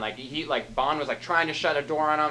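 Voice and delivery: normal talking voice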